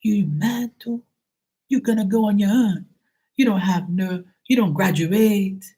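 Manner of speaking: high, scolding mimicked father; dad impression. Father scolding his child over not graduating, high mocking imitation. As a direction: nag like an appalled dad, dragging out some words. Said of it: "he goes like this".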